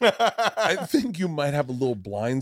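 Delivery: high, drawn-out tactful hedging; mock-tactful. Mock-tactful friend retold in bit.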